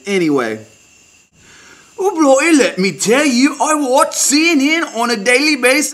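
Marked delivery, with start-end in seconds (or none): 2.0-5.9 higher mock Australian-British accent, pitched well up; a hammed-up half Australian, half British correspondent; bad Australian-British accent. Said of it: "bad Australian, half British accent".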